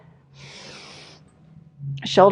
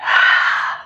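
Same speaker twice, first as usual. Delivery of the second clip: long hard breath out on exertion; forceful exhale. Real, not acted. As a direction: blow the air out sharply with effort. Exhaling through exercise reps.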